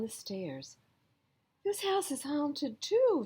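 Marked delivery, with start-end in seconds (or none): 1.6-3.2 Frog shrieks high and loud; frightened shout. A narrator's reading. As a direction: cry it out in panic. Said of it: "shouted Frog".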